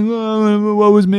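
frightened soldiers whining about doom, mock moan. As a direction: whimper it like a sulking soldier. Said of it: "There's moaning and whining".

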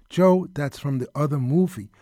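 normal talking voice

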